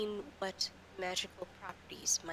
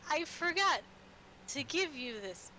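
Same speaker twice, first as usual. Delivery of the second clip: high, loud sing-song goodbye; cheery NPC farewell; 9 semitones higher, 4 dB louder than usual. Acted. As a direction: chirp a bright farewell as she leaves. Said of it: "She pulls out a tiny present".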